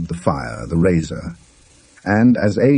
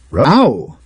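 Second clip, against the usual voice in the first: indignant echo, higher and louder; angry; 6 semitones higher, 4 dB louder than usual. A narrator's reading. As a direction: throw the word back at him, offended. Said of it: "said Mister Burton angrily".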